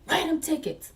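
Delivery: high-pitched mock order; imitated officer's command; mimicked bossy officer. Imitating officers ordering tickets written.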